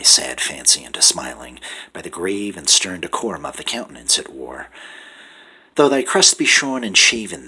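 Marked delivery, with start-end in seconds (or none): 5.8-7.5 mock challenge. Mocking address to the raven.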